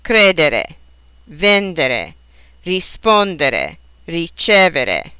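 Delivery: CRE-dere, VEN-dere, ri-SPON-dere, ri-CE-vere; stress third-from-last. Shown as a demonstration. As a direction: hit the syllable before the next to last in "Credere, vendere, rispondere, ricevere". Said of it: "accented on the syllable that precedes".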